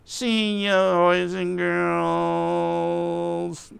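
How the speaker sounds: high, hugely drawn-out puppet farewell; slow, singsong-long puppet send-off; puppet character. Acted. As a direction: stretch the goodbye out in a high puppet voice. Puppet says goodbye to children.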